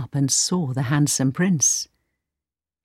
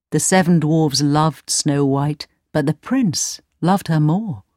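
usual speaking voice

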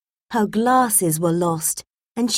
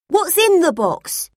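higher, eager question; boy asking Dad about the box; curious boy; 9 semitones higher than usual. A narrator's reading. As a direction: ask like a curious young boy. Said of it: "Tim said".

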